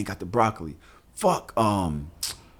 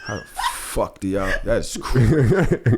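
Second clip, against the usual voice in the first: slow, stretched disbelief; drawn-out disbelief; slower than usual. Real, not acted. Host stunned by single mothers' workload, slow disbelief. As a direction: let it hang in awe.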